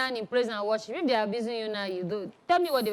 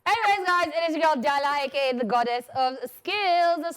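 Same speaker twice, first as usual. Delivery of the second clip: ordinary talk, nothing marked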